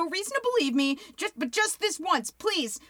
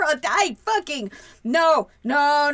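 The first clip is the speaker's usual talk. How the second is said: ordinary talk, nothing marked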